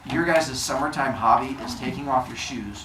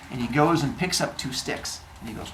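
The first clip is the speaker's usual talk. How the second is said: ordinary talk, nothing marked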